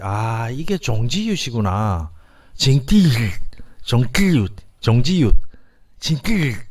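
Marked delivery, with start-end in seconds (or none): 6.0-6.7 징띠윷 high with a tense 띠; 징띠윷 said higher, tense middle sound; Navajo pronunciation. Shown as a demonstration. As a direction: model 징띠윷 with a hard 띠. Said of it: "이 발음이거든요".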